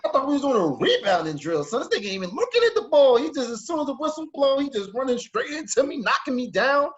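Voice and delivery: complaining to the coach about the tackle, indignant; indignant protest. Retold or imitated. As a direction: plead your case to the coach. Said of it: "I'm like".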